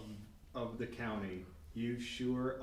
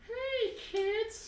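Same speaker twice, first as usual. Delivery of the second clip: much higher, put-on cartoonish greeting; cheesy mascot voice. [name] greeting the camera, a high cartoonish kids' host voice. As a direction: pop it up like a kids' show host.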